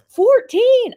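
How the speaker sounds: high, loud incredulous mimicry; imitated shock. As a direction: act out a stunned dispatcher exclaiming. Dispatcher's shocked reaction retold.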